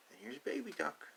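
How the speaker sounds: plain reading voice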